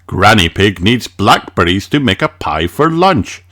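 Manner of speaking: plain reading voice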